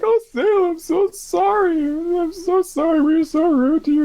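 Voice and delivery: hammed-up high-pitched sorry; mock tearful apology. Voicing apologetic characters.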